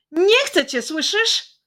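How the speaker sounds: loud, high shout. Acted. Boy shouting at his smartphone, loud high yell. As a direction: yell it at the phone in his hand. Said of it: "zawołał".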